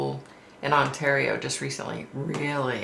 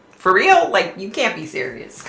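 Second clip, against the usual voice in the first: incredulous; 5 semitones higher, 4 dB louder than usual. Retold or imitated. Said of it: "I was like".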